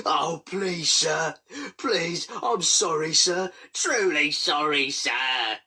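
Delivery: high, pleading whine; grovelling. A narrator's reading. Controller begging the inspector, high pathetic pleading. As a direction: beg pathetically on your knees. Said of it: "he grovelled pathetically".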